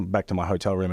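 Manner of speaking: ordinary talk, nothing marked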